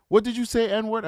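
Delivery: mildly acted angry demand; hostile challenge. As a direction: imitate an affronted, hostile stranger. Retold hostile man repeats challenge.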